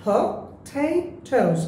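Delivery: potatoes with the middle TA pushed strong and drawn out slowly; stress on middle syllable. Shown as a demonstration. Teacher showing students where potatoes takes its stress, slow. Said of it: "এই ঠেইটা আমরা জোরে বলবো".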